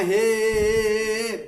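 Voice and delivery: prolonged calling "हे". Shown as a demonstration. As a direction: hold "हे" for three beats like a call. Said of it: "हम जो पुकारते हैं".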